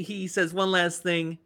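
ordinary delivery

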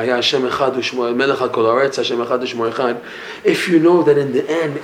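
ordinary talk, nothing marked

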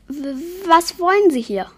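a startled creature demanding answers; frightened. A narrator's reading. Yeti confronting the vampire, frightened and stammering.

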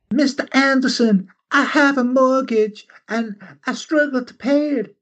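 high Southern belle voice. Pretend. Gunness coaxing Anderson about her mortgage, high Southern belle accent. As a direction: play a coy Southern belle pleading. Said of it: "she's a Southern belle".

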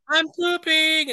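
very high, slow squeak; squeaky excuse. Acted. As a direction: drag out a squeaky embarrassed excuse. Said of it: "Barbara's like".

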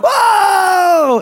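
high, drawn-out mock shout of whoa; crowd's stunned whoa. Friends reacting to a roast in a meme, hyped whoa. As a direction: imitate friends hollering at a roast. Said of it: "and they're all like".

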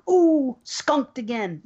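slow, drawn-out disappointed "Ooh"; mock disappointed drawl; drawn-out kid quote. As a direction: stretch it out like a letdown kid. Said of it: "and the kid goes".

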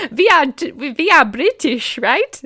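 high, loud, hammed-up German accent; badly disguised German passing as British, laughing through it. German soldier pretending to the trench to be British, comic German accent. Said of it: "Germany is the only accent I've studied".

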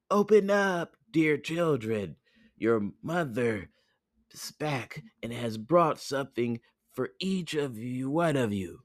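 high, sweet-talking fake mother; wolf imitating a gentle mother; wolf's softened voice. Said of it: "made his voice soft".

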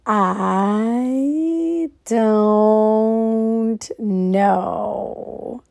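slow, drawn-out, detached inner voice; voice in her head. Shown as a demonstration. Saying the voice in her mind aloud for viewers, slow and detached. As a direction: let the words float out like a thought overheard. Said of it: "I'm gonna say it out loud so you guys can hear what I'm hearing in my mind".

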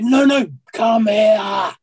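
retelling a panicked insistent plea to come back; urgent plea. Retold or imitated. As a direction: call him back in, alarmed. Frightened retold plea from stairwell.

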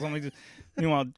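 normal talking voice

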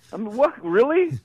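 high, loud incredulous what; hammed-up disbelief; mock disbelief. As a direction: act stunned by the remark.

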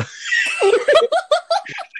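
high shrieking laugh. Real, not acted. Cracks up at own accent.

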